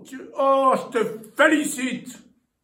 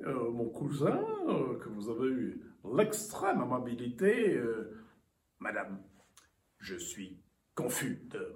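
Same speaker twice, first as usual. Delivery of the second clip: haughty aristocratic french; 12 dB quieter than usual. Acted. Formal visitor's flustered greeting.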